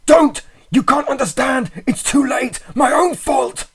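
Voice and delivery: high, loud, desperate scream; despairing scream. A narrator's reading. Friend screaming to narrator to stay away, despairing. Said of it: "changed to a scream to utter despair".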